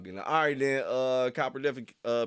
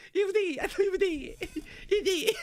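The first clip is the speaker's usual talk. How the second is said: laughing tease; 4 dB louder than usual. Real, not acted. Teasing chat back, laughing.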